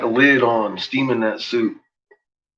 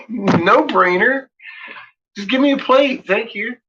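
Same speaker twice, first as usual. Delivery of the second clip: excited; 12 semitones higher than usual. Real, not acted. Laughing excitedly, high.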